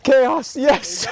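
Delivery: high loud triumphant cheer; excited cheer. Real, not acted. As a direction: cheer the catch. Landing the snook.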